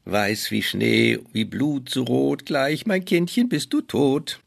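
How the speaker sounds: slow, sinister mock hiss; evil queen hissing. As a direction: gloat over the victim. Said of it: "zischt die Alte vor sich hin".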